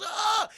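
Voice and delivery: high yelp as he drops; mock falling scream. Willie pushed off the pier by Nelson, falling yelp. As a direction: yelp while tumbling off the pier.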